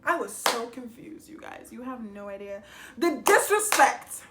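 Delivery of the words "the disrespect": loud, high, drawn-out indignation; outraged exclamation. Real, not acted. Venting outrage at boyfriend's behaviour.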